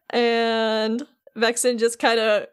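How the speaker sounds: normal talking voice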